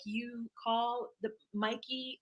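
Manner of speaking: slow, soft, word-by-word imitation; mock halting signing. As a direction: spell it out slowly like a hesitant signer. Mimics friends' halting signing.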